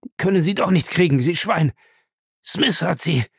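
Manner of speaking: high-pitched, spiteful outburst; defiant jeer from a beaten man; angry taunt. Acted. Beaten Lacey taunts and insults Jim.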